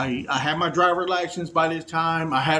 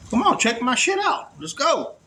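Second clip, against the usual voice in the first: impatient demand; 9 semitones higher than usual. Retold or imitated. Impatient with cashier.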